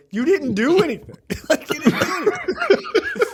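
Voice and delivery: high, laughing you didn't do anything; mock him through laughter; laughing mockery. Real, not acted.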